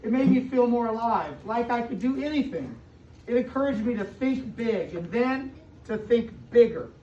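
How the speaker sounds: expressive storybook read-aloud to a class; reading to children. A narrator's reading. Teacher reading a picture book to her class, storybook voice.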